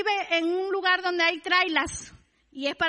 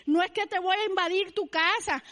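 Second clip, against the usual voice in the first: normal talking voice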